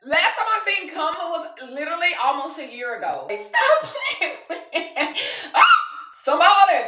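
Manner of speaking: fast, high, laughing through the words; can't stop laughing from excitement; laughing talk, high and giddy; laughing giddily. Real, not acted.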